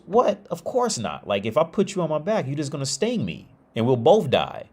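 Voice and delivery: high-pitched, incredulous refusal; incredulous frog. Acted. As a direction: act out a frog balking at the very idea. Frog refuses scorpion's request in story.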